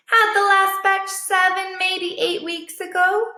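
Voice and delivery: lightly voiced matter-of-fact stray; a hint of a high dog voice; Boss voice. A narrator's reading.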